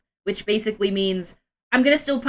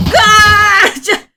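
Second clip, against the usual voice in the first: much higher, much louder victory shout; triumphant shout; 8 semitones higher, 10 dB louder than usual. Real, not acted. Streamer completing every quest at once, shouting in triumph. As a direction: yell it like you just won.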